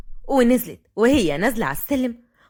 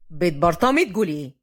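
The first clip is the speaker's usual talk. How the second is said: low-pitched male figure, even; young man's voice; 7 semitones lower than usual. A narrator's reading. Fahd answers his grandfather, low and even.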